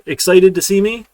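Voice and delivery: loud, high pun delivery; excited punchline. Acted. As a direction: land the pun with a grin.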